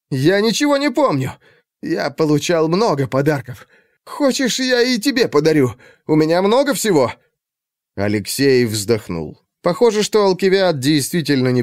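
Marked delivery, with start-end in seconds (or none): 0.1-7.1 high, pleading character voice; frightened captive aristocrat. Acted. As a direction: babble like an anxious captive offering a bribe. Acts frightened captive aristocrat in narration. (высоким голосом, заискивающе и испуганно)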